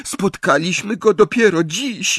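high, sobbing plea; Hermione. A narrator's reading. Hermione answers her torturer, tearful high sobbing. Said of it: "załkała Hermiona".

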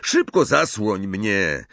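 slow, drawn-out queasy begging; beg, dragging the words out; pleading. A narrator's reading. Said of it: "błagał ją Ron". (błagalnie, przeciągając słowa)

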